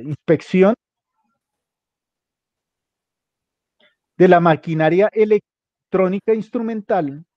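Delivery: slow dictation, phrase by phrase; dictating. Real, not acted. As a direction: dictate it slowly for someone copying. Dictating text for students to copy.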